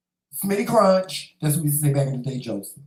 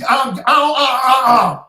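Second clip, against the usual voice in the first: loud, higher sputtering yell; mock parent shout; 7 semitones higher, 10 dB louder than usual. Parent scolding a cussing child, loud sputtering shout. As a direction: splutter at the kid, full volume. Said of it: "you tell your child".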